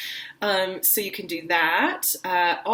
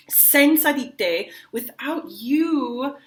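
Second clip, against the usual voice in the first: ache over each word; longing; 4 semitones higher, 4 dB louder than usual. Shown as a demonstration. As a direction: lover pleading that life is nothing without her. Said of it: "color each of those things as a different thing".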